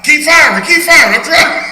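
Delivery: high, loud panicked yell in battle; frantic shouting. Acted. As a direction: scream orders under fire, laughing. Acting out frantic firefight.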